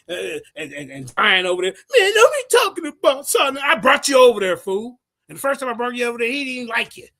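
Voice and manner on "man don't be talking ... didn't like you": high, loud imitated telling-off; hammed-up outraged quote; mock scolding. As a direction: act out his angry rant, high and fast. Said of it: "that's what he told me today".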